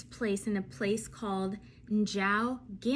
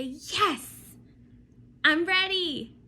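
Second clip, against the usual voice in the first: louder, higher eager "I'm ready"; modelled eager answer; 8 semitones higher, 8 dB louder, much slower than usual. Shown as a demonstration. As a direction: show the kids how to answer with gusto.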